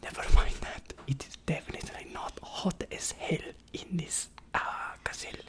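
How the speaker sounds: soft whispered aside; asmr whisper. Acted. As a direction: whisper it close.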